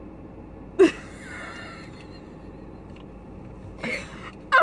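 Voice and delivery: very high, breathless giggle; soaring squeal of laughter. Real, not acted. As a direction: lose it completely. Laughing fit again.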